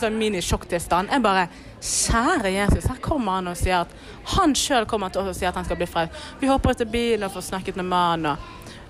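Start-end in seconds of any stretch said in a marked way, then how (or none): 1.8-2.9 slow, stunned breath of a prayer; stunned Kjære Jesus. Retold or imitated. Speaker stunned when a stranger asks for salvation, slow awed prayer. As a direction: let it out slowly in shock.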